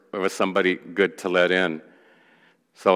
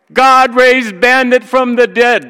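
high, loud, slow shout; ringing, drawn-out preacher's shout; triumphant proclamation; 8 dB louder than usual. Real, not acted. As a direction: cry out the miracle to the whole room. Preacher proclaims the miracle of story.

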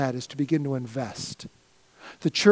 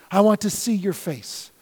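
ordinary talk

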